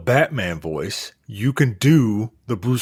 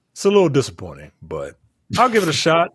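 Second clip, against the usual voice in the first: ordinary delivery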